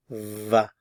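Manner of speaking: va, the German W said as V; V sound of W. Shown as a demonstration. Teacher isolates the W sound for learners, clear va. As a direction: isolate the va at the start of warum. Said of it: "notice the pronunciation of the W".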